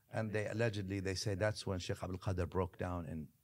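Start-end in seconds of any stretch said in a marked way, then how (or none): none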